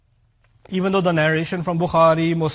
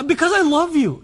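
defensive retort; 10 semitones higher, 5 dB louder than usual. Retold or imitated. Defensive spouse acted.

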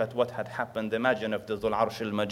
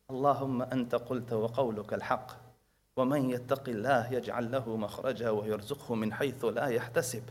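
soft quick prayer; 3 semitones lower, 7 dB quieter, much faster than usual. Acted. Girl prays in back room.

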